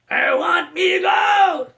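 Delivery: very high, slow shouted leprechaun. Retold or imitated. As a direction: bellow for his gold like a greedy leprechaun. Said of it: "doing an impersonation of Warwick Davis' voice of the leprechaun".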